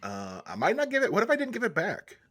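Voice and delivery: ordinary delivery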